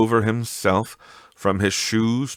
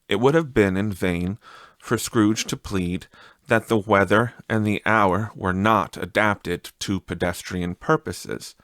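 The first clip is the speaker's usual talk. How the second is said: plain reading voice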